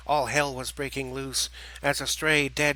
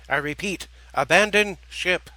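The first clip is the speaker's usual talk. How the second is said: louder, higher repeated order; louder repeated command; 5 semitones higher, 7 dB louder than usual. Acted. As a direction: hammer the repeat home. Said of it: "spoke with volume and authority".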